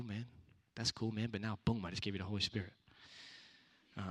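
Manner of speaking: usual speaking voice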